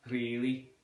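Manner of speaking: "Really" with a Scouse rolled R. Shown as a demonstration. Teacher demonstrating Liverpool accent, "Really" with rolled R. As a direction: trill the R in "Really". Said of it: "using the rolling of the R's".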